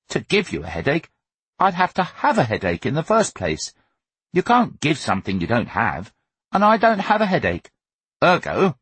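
put-on high-pitched, pompous boy's reasoning; grand. A narrator's reading. Son reasoning with his mother, grandly. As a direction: lay out your logic grandly. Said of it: "he said grandly".

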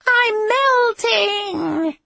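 very high, loud, long-drawn wail; Wicked Witch. A narrator's reading. Abby answers Merrick's question, wailing in a witch voice. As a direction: shriek it out slowly like a melting witch. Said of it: "wailed in a Wicked Witch voice".

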